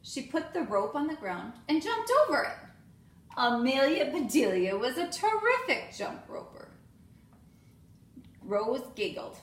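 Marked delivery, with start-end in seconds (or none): none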